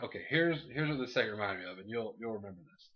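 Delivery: normal talking voice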